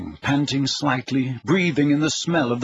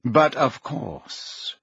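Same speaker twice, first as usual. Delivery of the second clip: slow Snape voice; 2 semitones lower, much slower than usual. Acted. The man lets her in.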